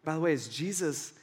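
normal talking voice

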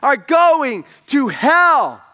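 slow, high, loud preaching yell; yelling at the top of his lungs. Retold or imitated. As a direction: belt it out slowly, pleading and furious. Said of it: "yelling at them at the top of his lungs".

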